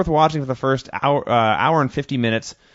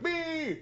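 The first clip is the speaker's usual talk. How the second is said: high, softer "beee" squeal; imitated squeal; 12 dB quieter than usual. Host mimicking the wrestler hitting a move, high squeal. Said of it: "he went".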